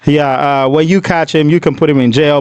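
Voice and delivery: a hint of a bored, unbothered father; mock calm suspect. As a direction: play the careless dad brushing it off. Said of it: "Like, he doesn't care".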